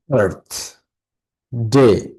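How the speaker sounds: "Birth", a long pause, then "ডে"; syllable by syllable. Shown as a demonstration. Teacher demonstrating the clap word, "birth" then "ডে" apart. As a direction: separate "birth" and "ডে" as two beats. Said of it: "birth বলার সময় কি হাততালি দিবে".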